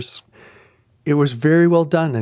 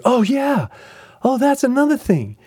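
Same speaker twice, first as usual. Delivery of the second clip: excited; 11 semitones higher than usual. Retold or imitated. Retelling her excited reply.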